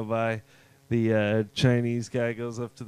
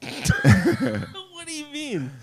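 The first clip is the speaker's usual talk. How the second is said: high, slow, stretched question; silly high drawl; 12 semitones higher, much slower than usual. Pretend. Friend playing dumb about the bog, high slow goofy voice. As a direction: ask it like a goofy cartoon.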